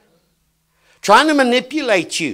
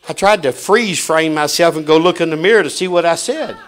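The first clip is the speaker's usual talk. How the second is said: ordinary talk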